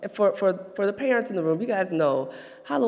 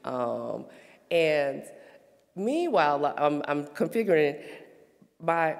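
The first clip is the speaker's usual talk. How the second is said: usual speaking voice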